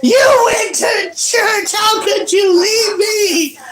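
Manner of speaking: put-on very high, slow wailing child's shout; whiny kid excuse. Kid blames mom for leaving, high whiny wail. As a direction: wail it like a betrayed little kid. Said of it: "my excuse was".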